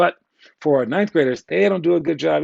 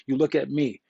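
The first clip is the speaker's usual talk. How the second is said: ordinary delivery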